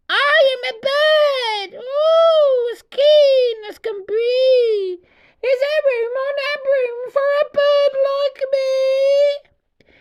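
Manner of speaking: very high, slow, loud squawky bird. Acted. Green bird asking the witch for a ride, high squawk. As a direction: screech it like a keen green bird. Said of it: "then said".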